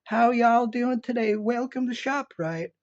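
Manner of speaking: high, bright-put-on southern shop greeting; southern twang. Shop clerk greeting a customer in a Southern twang. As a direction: imitate a friendly Appalachian twang, drawn out. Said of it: "this kind of twang".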